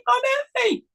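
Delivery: high mock wailing cry. Dad imitating son wailing for mommy, high mock cry. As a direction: imitate a toddler crying for mommy.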